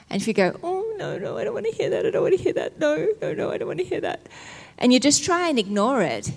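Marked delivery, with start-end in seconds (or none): 0.6-4.3 high, hushed, fretful protesting; mock panicked refusal. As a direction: act out blocking your ears, pitched high. Acts out refusing the enemy's accusations.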